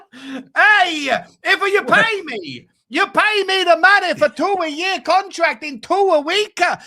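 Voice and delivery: loud, hammed-up Italian manager accent; emphatic, drawn-out Italian accent. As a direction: rant in comic Italian English, stretching the punchline. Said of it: "doing an Italian accent again".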